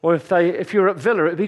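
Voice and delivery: ordinary talk, nothing marked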